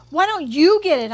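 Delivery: high, loud retort; contained scream of protest. A narrator's reading. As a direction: throw the question back loudly. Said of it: "I screamed".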